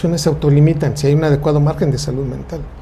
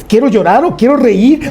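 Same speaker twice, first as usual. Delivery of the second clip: confused outburst; 8 semitones higher, 5 dB louder than usual. Retold or imitated. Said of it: "Hay personas que dicen".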